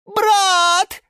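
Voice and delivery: very high, loud drawn-out yell. A narrator's reading. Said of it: "завопил Сушил громче".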